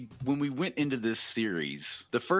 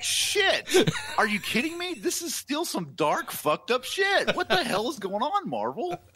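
laughing outrage; 12 semitones higher than usual. Retold or imitated. Fan ranting at Marvel about dark episodes, high laughing outrage. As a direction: rant at Marvel through laughter, stretching the words.

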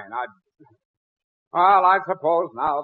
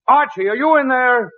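calling out; 8 semitones higher, 5 dB louder than usual. Acted. Calling through locked door.

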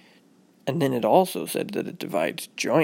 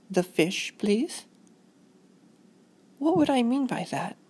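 lightly voiced motherly lilt; walk a child through it gently; mild teaching-mom voice; patient mother; 8 semitones higher than usual.

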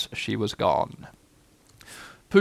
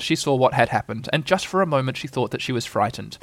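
ordinary delivery, nothing marked

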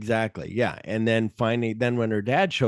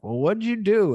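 high, slightly louder accusing question; mock officer; 9 semitones higher, 3 dB louder than usual. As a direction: ask it pitched up and pushy. Acted officer questioning her.

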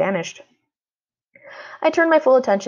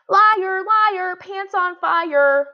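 much higher sing-song taunt, slowing at the end; 12 semitones higher, 3 dB louder, much slower than usual. A narrator's reading. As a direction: tease him like a bratty kid. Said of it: "Violet taunted".

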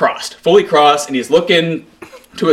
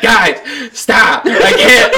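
laughing plea; 8 semitones higher, 3 dB louder, much slower than usual. Retold or imitated.

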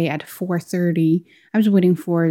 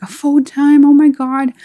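high, loud delighted disbelief; excited cry at the good news; thrilled shout; 8 semitones higher, 7 dB louder than usual. Retold or imitated. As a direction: burst out in joyful shock. Hearing it's full-time.

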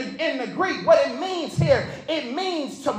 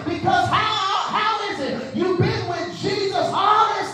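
preaching at full force; 4 semitones higher than usual. Acted. Pastor pressing the Broadway congregation, in full preaching force. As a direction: drive every line home like a revival preacher.

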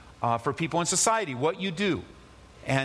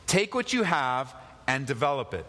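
ordinary talk

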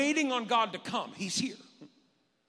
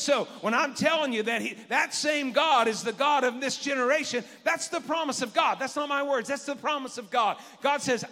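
louder, higher preaching build; full-force preaching; 7 semitones higher, 5 dB louder than usual. Acted. Pastor exhorting the congregation, full-force preaching. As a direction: preach it with fire.

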